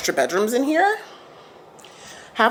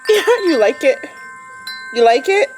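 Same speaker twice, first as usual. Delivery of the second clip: pet talk. Real, not acted. Asking the dog.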